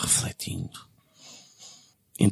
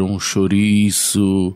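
slow deadpan; 3 dB louder, much slower than usual. Acted. Slow comic punchline.

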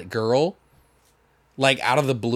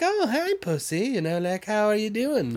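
cooing to a cat; 5 semitones higher, 5 dB quieter than usual. Retold or imitated. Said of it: "He's like".

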